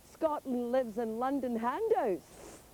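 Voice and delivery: slow, lightly voiced mock MP; a hint of a haughty Westminster speech; pompous English MP. English MP standing up in parliament, lightly mocked. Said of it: "English M Ps standing up saying".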